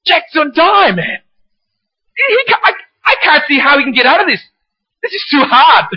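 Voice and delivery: high-pitched, worked-up mock panic; agitated. Husband fretting to his wife over a TV hero, acted agitated. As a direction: fret aloud like a hooked TV viewer. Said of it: "I'm getting all agitated".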